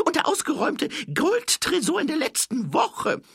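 high, loud, hurried character voice; eager boastful thief. A narrator's reading. Thief claiming a robbery to the watchman, high eager shout. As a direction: brag loudly in a high-pitched put-on voice.

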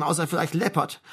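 usual speaking voice

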